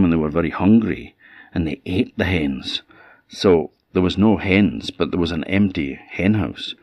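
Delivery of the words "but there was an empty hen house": usual speaking voice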